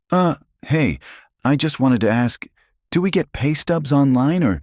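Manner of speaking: put-on high, hesitant kid; timid teenage boy asking; nervous teen voice. A narrator's reading. Hesitant teen asks boss.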